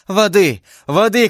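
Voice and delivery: high, urgent shouts; shouting. A narrator's reading. D'Artagnan calling for water for his beloved, shouting. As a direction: call out urgently for help. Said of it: "кричал Д'Артаньян".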